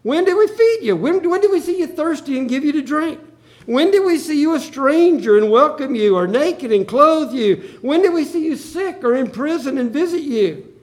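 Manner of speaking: high, loud, astonished pleading questions; shocked surprise. Acted. The righteous asking their Lord, shocked and surprised. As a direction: ask in disbelief, voice jumping up. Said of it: "They are clearly surprised".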